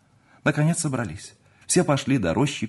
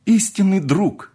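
high, fervent emotion, rising in force; slow down on the last words, overcome; ardent, drawn-out outpouring to a dear friend; moved to tears; 8 semitones higher, 5 dB louder, much slower than usual. A narrator's reading. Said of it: "говорил Адуев со слезами на глазах".

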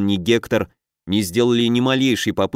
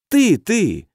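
insistent; 9 semitones higher than usual. A narrator's reading. Sphinx insisting.

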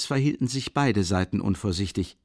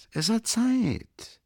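drawn-out high; 13 semitones higher, much slower than usual. Acted. Repeat with mock drawl.

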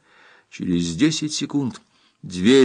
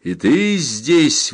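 raised, long-drawn exclamation; surprised exclamation; 5 semitones higher, much slower than usual. A narrator's reading. Captain sees the girl among rescuers, exclaiming. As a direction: stretch the words in glad surprise. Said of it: "воскликнул Сандакан, увидев Сураму".

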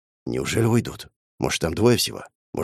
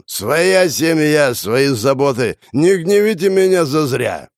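put-on very slow, louder drunken bluster; 5 semitones higher, 4 dB louder, slower than usual. A narrator's reading. Prokhor keeps up his fake drunken act at the door. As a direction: drag it out like a drunk. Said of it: "пьяным голосом".

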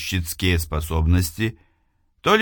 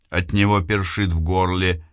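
ordinary delivery

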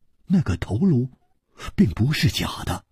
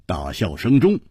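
plain reading voice

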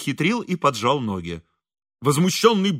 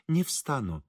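quiet refusal; 8 dB quieter than usual. A narrator's reading. Priest quietly refuses to rise.

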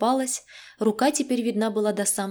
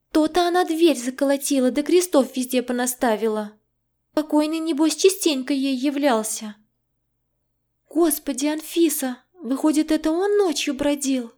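higher, lively indignant friend; agitated friend voice; 6 semitones higher than usual. A narrator's reading. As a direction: voice the rattled friend, a little higher. Zhenya's voice in story.

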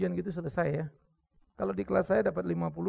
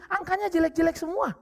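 ordinary talk

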